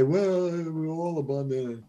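mock dreamy new-age voice; mocking spiritual talk. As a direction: parody a blissed-out guru, lightly. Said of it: "what my wife calls spiritual wah-wah".

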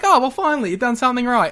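mock the one good decision; sarcastic relief. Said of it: "And they're just like".

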